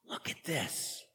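gasp, then soft whisper; awed whisper. Acted. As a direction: whisper in amazement.

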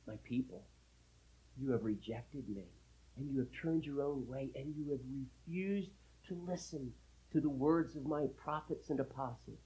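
hushed, measured rebuke; God speaking. Acted. God addressing the people who rejected him, softly grave. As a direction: softly lay the charge before your people. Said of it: "God said".

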